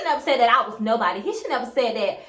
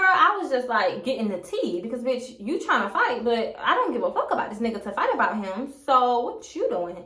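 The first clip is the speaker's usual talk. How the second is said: normal talking voice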